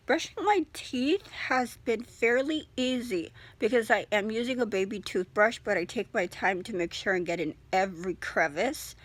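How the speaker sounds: usual speaking voice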